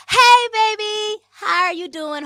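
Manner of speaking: high, loud, drawn-out squeal of hello; gushing greeting. Real, not acted. Host hailing a viewer mid-ramble, gushing greeting. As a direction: greet them big, stretching out each word.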